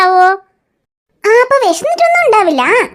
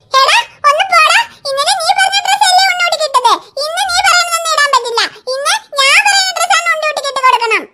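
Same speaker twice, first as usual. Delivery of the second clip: put-on much higher, giggling child voice; squabbling little girl; 8 semitones higher than usual. Chukki arguing with Tinku over the baby's dress. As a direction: tease her brother in a playful squabble.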